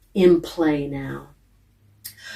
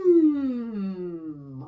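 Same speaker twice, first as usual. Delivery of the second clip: gliding hum. Shown as a demonstration. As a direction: slide the hum down from the top. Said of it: "New breath".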